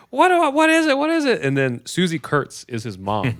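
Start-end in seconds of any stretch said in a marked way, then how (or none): 0.1-1.4 put-on high, loud panicked kid; squealed, hammed-up alarm; mock panic. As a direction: imitate a sheltered boy freaking out. Retold bubble boy panics at body.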